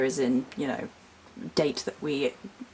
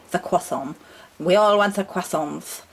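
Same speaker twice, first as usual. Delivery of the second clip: put-on strange French accent; 3 dB louder than usual. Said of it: "I've gone into a strange French accent".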